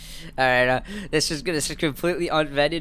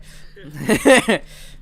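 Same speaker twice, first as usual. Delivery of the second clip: burst of laughter; 7 semitones higher, 4 dB louder than usual. Real, not acted. Laughs at missing janitor.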